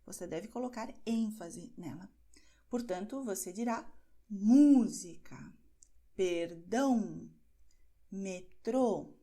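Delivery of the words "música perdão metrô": MÚ in "música", DÃO in "perdão", TRÔ in "metrô" drawn out and loud; stressed accented syllable. Shown as a demonstration. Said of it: "eu estou destacando a sílaba onde está esse acento". ("música, perdão, metrô" com a sílaba acentuada bem destacada)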